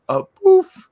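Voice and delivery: high, loud, far-off mock of the line; mocking movie voice. Retelling the alcalde scene to James, high, loud mock delivery. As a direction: squeak it out as the film character. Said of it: "he said it more like a".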